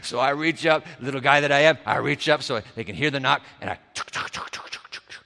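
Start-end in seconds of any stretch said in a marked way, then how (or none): 3.6-5.2 soft breathy tuk tuk knocks, no voice; knocking sound. Retold or imitated. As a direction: tap out a knock with the tongue. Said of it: "I knock".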